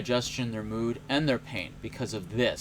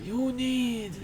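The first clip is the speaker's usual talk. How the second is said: slow, higher Sicilian mentor voice; Sicilian accent; 6 semitones higher, much slower than usual. Retold or imitated. Mentor's advice retold in a slow Sicilian accent. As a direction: draw it out like a Sicilian tough guy. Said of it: "I can't do a Sicilian accent".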